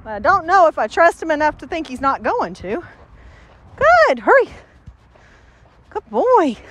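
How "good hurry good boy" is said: squeaky-high praise for the dog; good, sung up high; excited dog praise. Real, not acted.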